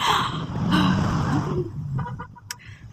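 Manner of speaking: a long loud high gasp of excitement; excited gasp. Real, not acted. Vlogger gasping with excitement about meeting him. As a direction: gasp like the news just hit you.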